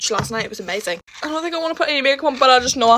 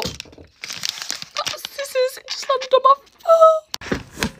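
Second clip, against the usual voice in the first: high, slow, crying whine; pained whine; 13 semitones higher, much slower than usual. Real, not acted. Girl whimpers to viewers after something lands on her foot.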